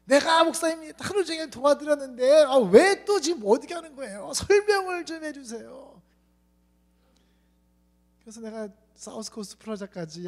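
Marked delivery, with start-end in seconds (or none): 0.1-6.0 high, loud protesting youth; complaining young man. Retold or imitated. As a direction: whine it out insistently.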